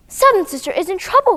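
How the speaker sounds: cried out in alarm; alarmed cry. Acted. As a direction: call out that the baby is in danger. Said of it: "cried first sister".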